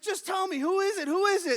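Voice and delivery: very high, loud mock desperation; exasperated dispatcher. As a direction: beg loudly, building to a shout.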